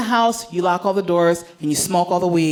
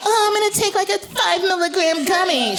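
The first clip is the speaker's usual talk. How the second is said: high, mincing mock of a timid user; mocking a five milligram gummy user; mocking lightweight; 9 semitones higher than usual. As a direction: sneer at a weed amateur in a high voice. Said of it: "I don't do, like".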